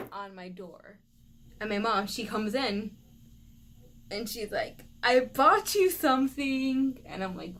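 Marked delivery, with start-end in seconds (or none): 5.0-7.0 high, slow singsong announcement; mock-grand gift reveal in mom's voice; mom's proud announcement. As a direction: draw it out loudly, imitating mom with a gift. Mom announcing her surprise gift.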